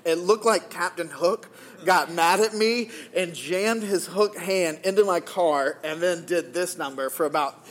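ordinary talk

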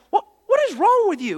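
high, loud exasperated question; imitating irritation. Irritated man catching the preacher in the aisle, exasperated. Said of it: "He was kinda irritated".

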